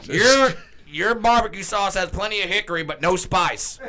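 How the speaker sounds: loud, high folksy pronouncement. Acted. Commentator offering nonsense sayings, emphatic mock folksiness. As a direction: declare it like a Southern announcer. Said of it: "us doing J R impressions".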